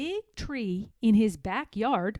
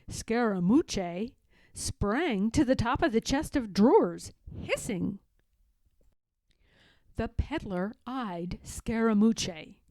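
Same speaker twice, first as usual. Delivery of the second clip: ordinary delivery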